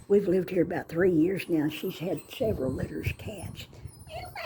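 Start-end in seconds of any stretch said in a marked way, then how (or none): 4.1-4.3 little high meow call; cat meow. Real, not acted. Meowing to the cat.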